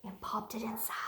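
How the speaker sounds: story whisper, sneaking. Acted. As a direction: hush it like a secret. Secret penguin smuggling in story.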